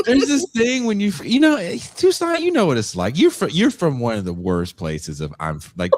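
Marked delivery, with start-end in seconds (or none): none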